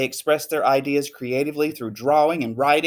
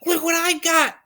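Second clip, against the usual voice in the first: can't hold it in any longer; Lily showing off her purse, high and excited; excited outburst; 14 semitones higher than usual. Acted.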